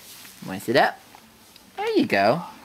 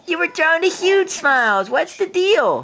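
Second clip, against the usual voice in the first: put-on baby talk; 13 semitones higher than usual. Dad teasing baby.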